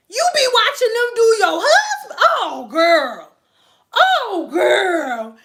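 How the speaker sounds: loud, high laughing disbelief; shrieking oh girl through laughter. Retold or imitated. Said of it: "she said".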